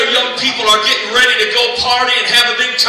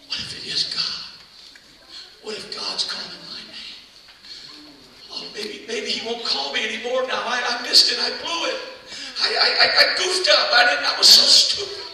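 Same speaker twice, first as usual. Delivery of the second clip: anxious whisper; 6 dB quieter than usual. Acted. Anxious boy worrying in the dark.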